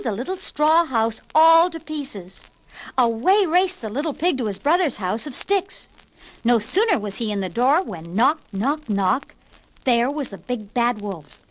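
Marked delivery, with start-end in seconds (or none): none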